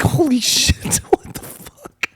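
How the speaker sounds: high, shocked outburst fading away; squeaky-high alarm, then dropping off; mock freak-out. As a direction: reenact being startled out of your skin. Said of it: "freaking out".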